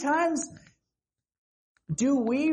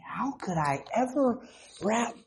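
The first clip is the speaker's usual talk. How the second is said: ordinary talk, nothing marked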